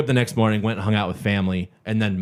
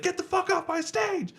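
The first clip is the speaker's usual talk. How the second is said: angry yell; 6 dB quieter than usual. Retold or imitated. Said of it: "started yelling".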